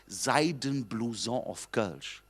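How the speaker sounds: "Seidenblouson" said very slowly and drawn out; slow announcement. Shown as a demonstration. Comedian teasing audience, slow riddle announcement. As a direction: lay out "Seidenblouson auf Kölsch" slowly like a riddle. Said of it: "So, so".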